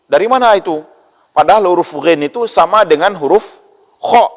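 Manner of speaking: usual speaking voice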